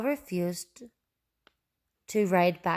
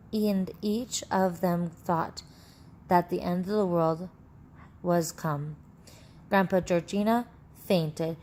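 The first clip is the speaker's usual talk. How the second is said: ordinary delivery, nothing marked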